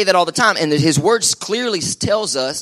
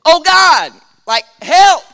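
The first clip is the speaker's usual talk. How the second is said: high, loud mock cry to heaven; desperate prayer cry; 12 semitones higher, 8 dB louder, much slower than usual. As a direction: belt out a hammed-up plea for help. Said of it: "How many ever said that prayer before".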